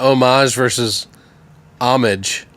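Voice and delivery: homage said French-style oh-MAHZH, then homage anglicized AH-mij; two pronunciations. Shown as a demonstration. Asking the language nerd about rival pronunciations, contrasting homage French and English.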